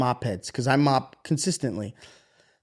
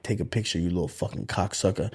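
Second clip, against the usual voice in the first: muttered curse; 7 semitones lower, 6 dB quieter than usual. Retold or imitated. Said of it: "And I'm like".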